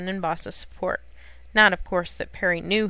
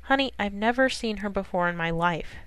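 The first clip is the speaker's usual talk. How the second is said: ordinary delivery, nothing marked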